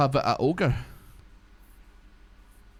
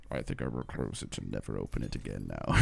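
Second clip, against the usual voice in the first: much lower, softer mumble; timid retort to a street heckler; meek reply; 14 semitones lower, 7 dB quieter than usual. Retold or imitated. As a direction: mutter it sheepishly. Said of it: "And I was like".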